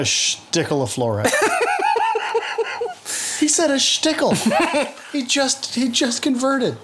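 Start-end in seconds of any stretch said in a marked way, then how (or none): 1.4-3.4 high burst of real laughter; big laugh. Real, not acted. Laughing at the word shtickle, high real laugh. 3.4-6.7 high, laughing repeat of the word. Real, not acted. Teasing the guest about his word, laughing high.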